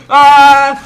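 sharp shriek of fright; startled scream. Real, not acted. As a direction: yelp as if jumped on. Sudden fright in game. (grito de susto)

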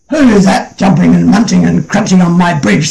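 lightly voiced grumpy troll; a hint of a cross troll demanding; troll roused. A narrator's reading. Troll woken from his nap, lightly gruff and cross. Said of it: "said the troll".

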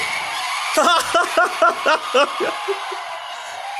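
long, high cackling laugh. Real, not acted. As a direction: burst out laughing, high and drawn out. Laughs at the fall.

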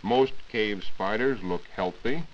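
ordinary delivery

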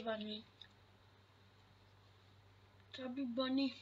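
words mushed by a full mouth; cheeks stuffed full. Real, not acted. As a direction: mouth crammed with marshmallows. Marshmallows stuffed in, saying chubby bunny.

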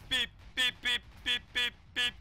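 monotone electronic beeps; evenly spaced mimicked beeps; imitated beeping. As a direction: beep like a machine on one note. Imitates countdown beeps while waiting.

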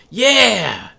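a sudden high, loud burst of glee; light up and shout it; excited yell. Acted. Kuno excited someone understood.

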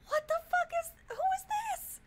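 very high-pitched disbelief; baffled squeal. Real, not acted. Streamer baffled by a new character, high squealed disbelief.

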